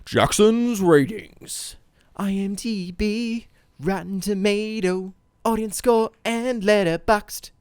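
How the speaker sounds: slow, drawn-out announcer build. Acted. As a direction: announce it like a game show host. Announcing the ratings segment.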